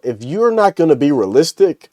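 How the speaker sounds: ordinary delivery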